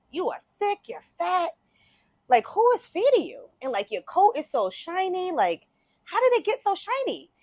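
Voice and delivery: high, marvelling questions; amazed wolf. Acted. Wolf admiring a well-fed dog's coat, amazed. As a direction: play a wolf astonished at what he sees.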